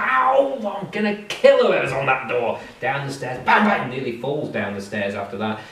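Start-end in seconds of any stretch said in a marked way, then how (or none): 0.0-2.6 pained yelp, then a grumbling threat; grumpy hungover mayor. Acted. Hungover mayor threatens whoever is knocking on the door. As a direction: sore head, angry at the door.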